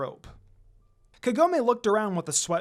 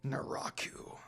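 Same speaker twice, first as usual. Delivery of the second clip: breathy whispered name; hissed name; 4 semitones lower, 11 dB quieter, slower than usual. Acted.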